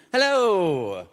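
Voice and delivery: high cheerful cartoon hello; Mario voice. Mario greeting.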